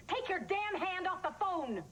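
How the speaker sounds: high, slow, emphatic yell; explode, drawing out each word; furious outburst. Acted. Mother on the phone erupting at a captor, furious yell.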